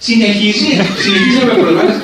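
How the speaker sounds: high, loud mock complaint; hammed-up offended shout with a laugh in it; retold quarrel. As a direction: imitate an insulted arguer protesting. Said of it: "και μαλώσαν".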